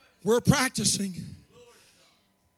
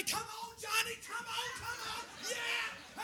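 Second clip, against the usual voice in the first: high cheering from the stands; yelling from the sidelines; 9 semitones higher, 17 dB quieter than usual. Retold or imitated. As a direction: cheer the grandson home. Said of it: "You jump up and say".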